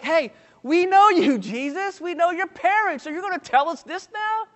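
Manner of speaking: high-pitched, disbelieving objection; acted indignant disbelief; incredulous challenge. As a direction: confront him as a doubting crowd. Acted skeptical crowd challenging Jesus.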